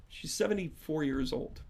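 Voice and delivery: ordinary talk, nothing marked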